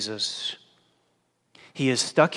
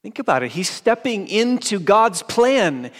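fervent preaching; 10 semitones higher, 10 dB louder than usual. Acted. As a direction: stretch each word out with force. Preaching at full force.

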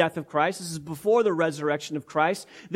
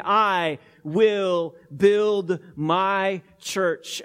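each word of "I will build my church" drawn out slowly and weighted; slow emphatic promise; 4 dB louder, much slower than usual. Acted. To the congregation, stressing Christ's promise slowly.

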